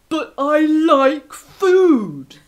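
slow, loud, sulky wolf; whiny wolf. Acted. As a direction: whine it like a greedy wolf. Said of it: "said the wolf".

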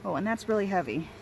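normal talking voice

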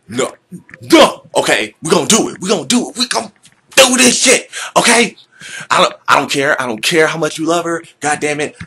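very high, loud, fast ranting; frantic urge voice. Acted. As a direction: lose it and insist, shouting. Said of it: "a conversation with ourselves".

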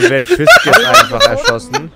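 high, loud laughing disbelief; laughing shock. Real, not acted. Player reacting to a shot teammate, laughing and high.

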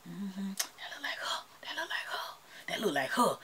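hushed, unvoiced whisper; whispered gossip. Strangers whispering about the YouTuber they spot. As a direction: whisper it behind her back. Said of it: "somebody said".

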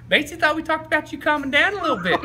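high, loud scolding coach impression; Spurrier. Mad coach confronting player in the training room, high loud imitation. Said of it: "He's mad at me".

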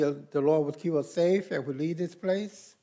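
ordinary delivery

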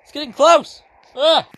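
high, loud cries of alarm; panicked yelping. Real, not acted. Player watching danger getting close, high panicked yelps.

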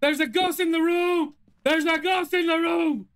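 high, frightened shout; panicked shouting. Real, not acted. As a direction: yell it in real panic. Sees a ghost, shouts.

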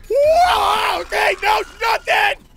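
high, loud startled yelling; startled shout. Real, not acted. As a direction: shout out in alarm. Startled by game events.

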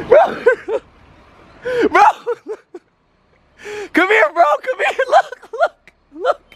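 laughing. Real, not acted. Laughing while revealing prank.

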